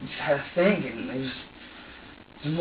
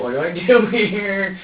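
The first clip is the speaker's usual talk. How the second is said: loud, higher, stretched-out plea; helpless exasperation; 6 semitones higher, 8 dB louder, slower than usual. Retold or imitated. Parent at a loss with a crying baby, helpless exasperation. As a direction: throw up your hands in frustration.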